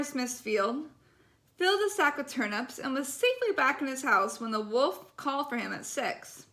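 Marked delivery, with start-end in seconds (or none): none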